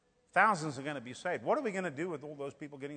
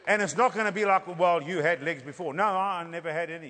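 raised, loud revival preaching, slowing on key phrases; fiery preaching; 7 dB louder than usual. Acted. Preacher telling the church of coming miracles, at full force. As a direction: drive the vision home to the congregation.

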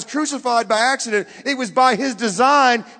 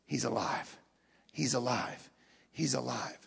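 very low, soft repeated declaration; hushed testimony; 9 semitones lower, 13 dB quieter than usual. Retold or imitated. Martyrs testifying as they die, hushed and low. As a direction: say it quietly, with conviction. Martyrs' dying words voiced.

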